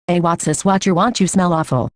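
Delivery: usual speaking voice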